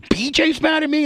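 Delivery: B J's mad at me? asked very high and innocent; mock innocent squeak. To the angry boss, in a high mock-innocent squeak. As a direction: play dumb in a falsetto squeak. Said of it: "I just go".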